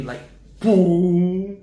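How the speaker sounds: loud hollow boom; mock belly-drum boom; booming thump. Shown as a demonstration. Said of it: "that full jar of water sound".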